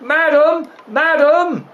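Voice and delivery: high, sustained summons; calling out. Acted. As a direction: call through the house for madam. Servant calls for the mistress.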